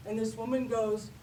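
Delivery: ordinary talk, nothing marked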